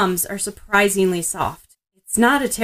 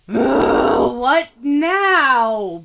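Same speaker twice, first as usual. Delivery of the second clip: loud, higher annoyed snap with a groan; fed up and impatient, pushed louder; 3 semitones higher, 7 dB louder, much slower than usual. A narrator's reading. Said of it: "Bakugo sounds just about as annoyed and impatient".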